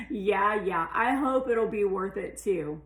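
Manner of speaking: loud, exasperated comeback; exasperated retort. A narrator's reading. Daughter to her mother on the phone, loud and exasperated.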